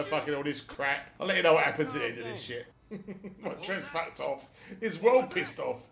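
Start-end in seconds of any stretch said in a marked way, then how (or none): none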